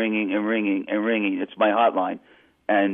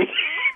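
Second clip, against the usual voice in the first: sudden squealing laugh, much higher; high burst of laughter; 4 dB quieter than usual. Real, not acted. Laughing mid-story.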